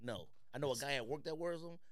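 ordinary delivery

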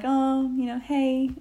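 high, awkward hello; nervous upbeat hi; awkward greeting. Retold or imitated. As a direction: greet him brightly to cover the awkwardness.

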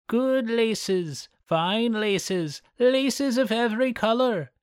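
put-on high old crone coaxing a sale; old peddler woman. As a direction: sweet-talk like a scheming old hag. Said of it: "dressed up like an old peddler".

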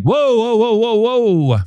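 high, startled whoas, pulling back in mock alarm. Host mimicking readers recoiling from mushy love talk, startled. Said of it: "that it's like".

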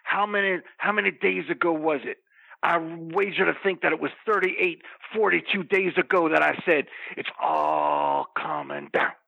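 low, intense wrestler promo voice; wrestling promo. Acted. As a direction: cut a heel promo, low and menacing. Wrestling promo character rant.

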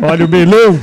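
loud vendor call; market barker. Pretend. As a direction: shout it like a fair vendor.

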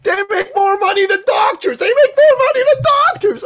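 very high, loud, cracking with laughter; excited shout. Retold or imitated. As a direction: yell it triumphantly. Dan's shouted reasoning.

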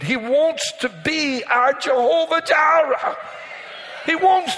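high, slow, ringing cry of Jehovah Jireh; preaching shout. Real, not acted. Pastor crying out to the congregation in a preaching shout. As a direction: lift it up and shout it to the church.

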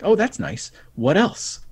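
high, airy feigned indifference; mock nonchalant.

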